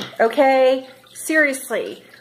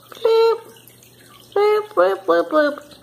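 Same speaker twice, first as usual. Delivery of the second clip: bloop bloop sung out higher; playful bloop noises; 7 semitones higher, slower than usual. Real, not acted. Dabbing on lipstick for viewers, playful bloops. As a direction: pop each bloop like a cartoon sound. Sound effect while applying lipstick.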